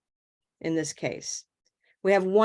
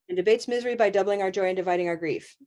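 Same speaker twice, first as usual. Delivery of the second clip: fast, unbroken; much faster than usual. Shown as a demonstration. Teacher jokingly showing the wrong chunking, rushed without breaks. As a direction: race through to the end with no pauses. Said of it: "we're gonna break it up into smaller pieces".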